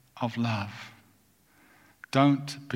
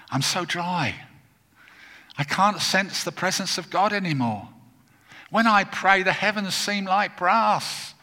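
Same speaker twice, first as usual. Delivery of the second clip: high, mounting cry of desperation; anguished seeker; 9 semitones higher, 4 dB louder than usual. Retold or imitated. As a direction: voice a desperate believer's complaint, growing louder and slower. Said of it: "said words like this to me".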